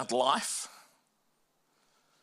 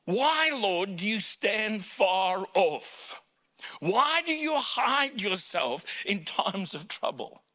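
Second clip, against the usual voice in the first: higher, pleading psalm lament; higher anguished lament; 4 semitones higher, slower than usual. Retold or imitated. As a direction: plead with God, slowly. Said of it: "pens these words".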